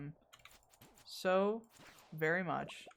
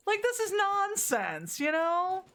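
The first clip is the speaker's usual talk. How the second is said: higher, slow, disbelieving complaint; exasperated outburst; 12 semitones higher, 3 dB louder, slower than usual. Real, not acted. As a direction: drag it out in fed-up disbelief. Frustrated with the quest.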